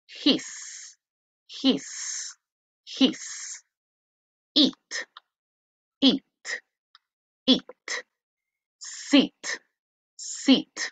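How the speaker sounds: "bin", "chip", "his", "eat", "sit" each with a clear Spanish I; teacher repeating each word three times for the class; Latin I vowel. Shown as a demonstration. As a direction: drill every word three times with a pure I.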